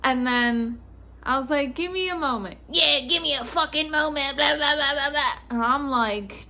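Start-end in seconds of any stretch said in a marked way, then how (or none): 1.7-2.6 higher-pitched, not raised in volume; placating request. Retold or imitated. Clerk asking a screaming customer to wait, placating. 2.7-5.3 raised, loud angry parroting; mock screaming. Customer throwing the clerk's words back, mock screaming. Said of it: "he started screaming at me".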